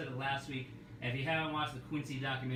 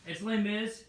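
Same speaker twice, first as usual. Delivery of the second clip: higher, louder, upbeat restart; fresh start; 6 semitones higher, 6 dB louder than usual. Real, not acted. Host restarting the Les Mis pitch, upbeat. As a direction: reset and sell it brightly. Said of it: "All right".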